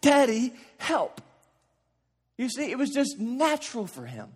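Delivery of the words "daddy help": high, loud frightened call for daddy; pleading child's cry. Retold or imitated. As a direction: cry out like a scared little boy. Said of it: "and cry out".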